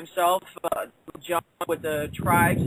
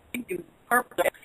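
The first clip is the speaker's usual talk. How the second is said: ordinary talk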